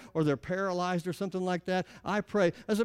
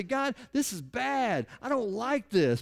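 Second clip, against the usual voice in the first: mild lament; 4 semitones higher, slower than usual. Pretend. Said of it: "I'm gonna cry out and say".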